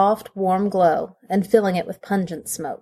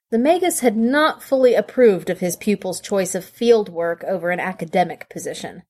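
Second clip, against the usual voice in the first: ordinary delivery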